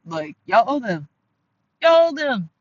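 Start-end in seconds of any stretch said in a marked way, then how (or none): 1.8-2.5 high, loud, insistent repeat; emphatic shout. Real, not acted. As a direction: yell the demand, pitch way up. Repeats the demand, second louder.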